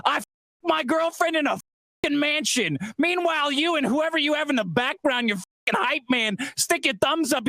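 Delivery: high furious yelling rant; angry shouting. Real, not acted. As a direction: yell it at him, furious and high. Furious rant at his rival.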